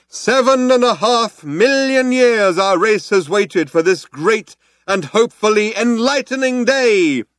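slow, high-pitched rallying call; cheerleader's cry. Acted. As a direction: whip up the crowd from the dais. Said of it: "cried the cheerleader".

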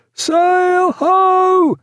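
high, loud masthead call; shouted hail. A narrator's reading.

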